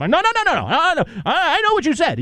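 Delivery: rapid, high-pitched denial; mock protest. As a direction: imitate a friend scrambling to defend himself. Cohost protesting being caught out.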